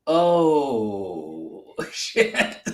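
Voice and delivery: long drawn-out oh, breaking into laughter; mock dawning dread. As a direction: imitate a sinking realisation, laughing. Retelling friend's sinking realisation, amused.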